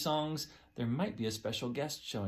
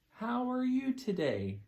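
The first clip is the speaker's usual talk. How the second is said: slow, high demo; 8 semitones higher, slower than usual. Shown as a demonstration. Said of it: "Can you say ... with your hands".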